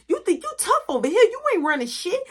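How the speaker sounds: high fast scolding; threatening mom. Retold or imitated. Mom scolding Mark through the door, fast and high.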